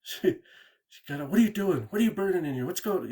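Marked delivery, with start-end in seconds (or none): 1.3-3.1 higher, louder alarmed questioning; freaked out. Retold or imitated. Cousin freaking out at the smudge smoke, high and loud. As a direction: throw out the questions in a sudden panic. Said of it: "kinda freaking out".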